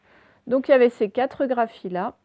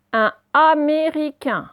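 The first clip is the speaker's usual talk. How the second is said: slow, loud, higher dictation of each syllable; 6 semitones higher, 6 dB louder, slower than usual. Shown as a demonstration. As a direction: sound "Américain" out slowly for pupils. Sounded out, then said normally.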